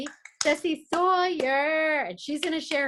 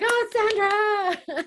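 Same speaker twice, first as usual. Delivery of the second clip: fond exclamation; 8 semitones higher, 6 dB louder, much slower than usual. Retold or imitated. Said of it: "And I was like".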